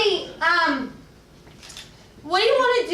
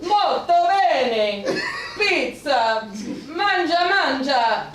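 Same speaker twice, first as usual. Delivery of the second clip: mock Italian accent; much slower than usual. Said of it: "Just say fancy Italian words".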